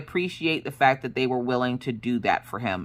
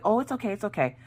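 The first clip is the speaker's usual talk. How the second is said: higher and softer, soothing the words; calm someone who is embarrassed; reassuring; 7 semitones higher, 5 dB quieter, faster than usual. Retold or imitated. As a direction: nurse gently reassuring the mother. Nurse calming embarrassed mother.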